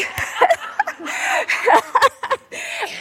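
high burst of giggles; laughing. Real, not acted. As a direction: laugh helplessly at your own remark. Laughs at her own remark.

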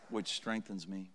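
very low, soft and measured; hushed verse ending. Real, not acted. Preacher finishing the recited verse with the congregation, hushed and reverent. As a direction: drop right down to finish the verse quietly.

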